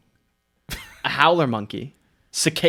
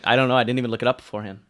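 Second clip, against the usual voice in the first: ordinary delivery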